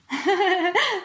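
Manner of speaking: high, light acted laugh; short amused giggle; mum's laugh. A narrator's reading. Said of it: "roześmiała się mama". (wysoki, lekki śmiech mamy)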